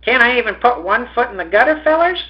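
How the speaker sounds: put-on high-pitched little boy voice; high, questioning child's plea; pleading little boy. A narrator's reading. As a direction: plead upward like a small kid.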